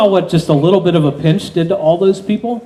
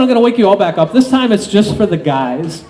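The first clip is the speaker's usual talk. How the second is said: ordinary talk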